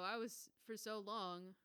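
normal talking voice

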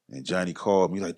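ordinary delivery

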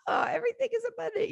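high, fast mock voice; lightly mocking sing-song; mock naive optimist. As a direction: squeak it out like a cheery naive believer. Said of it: "probably, like".

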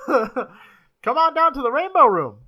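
loud, high radio ad voice; old-timey announcer. Pretend. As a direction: pitch it like a vintage ad. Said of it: "the old-timey voice".

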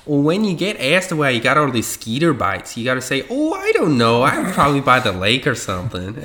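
playful mock Wisconsin accent. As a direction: put on a TikTok-style Wisconsin accent. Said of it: "in the Wisconsin accent".

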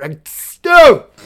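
a sudden high, loud burst of joy; real excitement bursting out; stoked. Real, not acted. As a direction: blurt it out, thrilled. Happy after friends cheered him.

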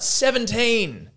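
slow, high pub call of "Seventeen"; a drunk bellowing a number at the bar; drunk yelling out. Acted. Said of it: "stands up and yells out".

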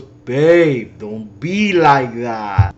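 loud, drawn-out mock plea; hammed-up boyfriend begging her to stay; pleading boyfriend. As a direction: call after her, stretching the words slowly. Acting boyfriend pleading as she leaves.

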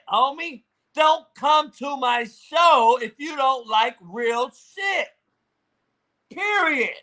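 high, forceful defiant rant; yelling. Real, not acted. Host telling chatters to stay out of his room, yelling. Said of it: "I'm yelling".

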